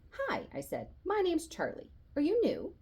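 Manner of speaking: high friendly hello; eager greeting. A narrator's reading. Charlie greets the new boy, high and friendly. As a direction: welcome the new kid brightly.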